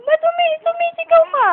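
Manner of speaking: squeaky high coaxing voice; high playful baby talk. Acted. Mother cooing high and loud at her baby. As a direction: pitch way up and tease the baby.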